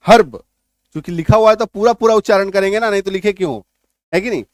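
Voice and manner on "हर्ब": हर्ब punched out loud as "harb"; full R pronunciation. Shown as a demonstration. Teacher mimicking students' mistake, हर्ब with full R. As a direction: hit the R in हर्ब, short. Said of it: "हम लोग क्या बोलते हैं".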